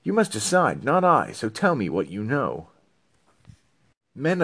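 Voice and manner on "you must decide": normal talking voice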